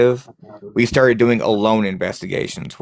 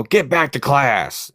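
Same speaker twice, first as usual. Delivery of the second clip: slow, higher barked order; pissed off janitor; 4 semitones higher, slower than usual. Retold or imitated. An angry janitor ordering ghosts back to class, slow and mild. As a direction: play an angry janitor, lightly. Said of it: "acting like I'm a pissed off janitor".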